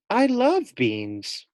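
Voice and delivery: high, slow, delighted. A narrator's reading. Fluffy the hamster thrilled about beans, slow and delighted. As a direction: savour the word love. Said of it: "thought Fluffy".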